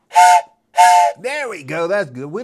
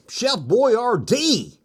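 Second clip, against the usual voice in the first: jingle-style announcement; 5 semitones higher, much slower than usual. Pretend. Showing the Chef Boyardee boxcar to viewers, slow mock ad read. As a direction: announce it like an old TV ad. Repeating his ad-style call.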